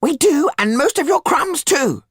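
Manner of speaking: very high, loud rebuke; sharp. A narrator's reading. Tetu rebukes Didier spitting crumbs, sharp. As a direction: cut in sharply, pitched right up. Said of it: "said Tetu sharply".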